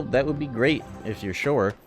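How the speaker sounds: ordinary delivery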